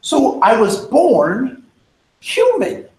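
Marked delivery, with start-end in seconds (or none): none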